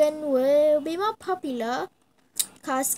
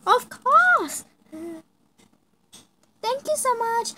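excited fan; 9 semitones higher, 6 dB louder than usual. Acted. Fan accepts photo.

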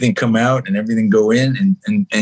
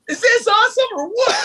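very high, excited exclamation; mock cheer. Alone on a private jet, mock cheering to nobody. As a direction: whoop it like a kid in a jet.

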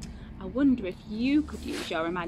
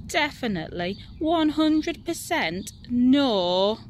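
louder, emphatic, stretching each word; insistent; 5 dB louder than usual. Acted. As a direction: spell it out, no tiger at all. Nora insists, exasperated.

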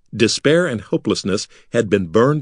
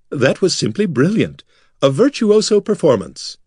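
gushing; 8 semitones higher than usual. A narrator's reading. Corin gushing praise.

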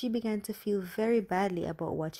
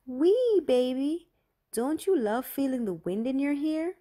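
much higher, louder singsong taunt; mock-playful teasing; 7 semitones higher, 5 dB louder than usual. A narrator's reading. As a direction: tease your sister with a gleeful whoop. Teasing while dangling sister's doll.

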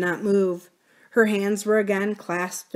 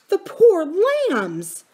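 pitying; 11 semitones higher, 6 dB louder, slower than usual. Acted. Pitying the motherless boys.

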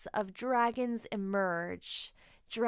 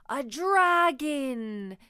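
loud, slow, wide-eyed cry; two children discover a dragon costume; amazed gasp; 4 semitones higher, 7 dB louder, much slower than usual. A narrator's reading. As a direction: gasp it out in wonder. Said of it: "gasp Sam and Willa".

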